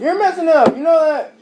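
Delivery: loud, high, exasperated scolding; angry yelling. Real, not acted. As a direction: yell at them for ruining it. Scolding family for ruining video.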